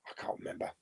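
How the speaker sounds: breathy muttered whisper to self; whispered aside. Real, not acted. Whispering to the chat that she can't remember. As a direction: drop to a whisper, thinking aloud.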